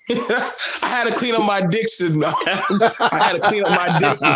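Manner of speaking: real giggle while owning up; laughing. Real, not acted. Laughing at being teased about diction.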